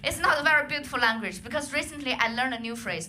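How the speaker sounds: ordinary talk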